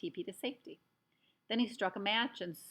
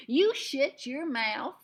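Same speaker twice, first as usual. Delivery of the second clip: loud, higher, slow Texan snarl; the tiny cowboy barking back at a giant; shouted Texas cowboy put-down; Texas accent; 6 semitones higher, 10 dB louder, much slower than usual. A narrator's reading. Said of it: "said the great little man".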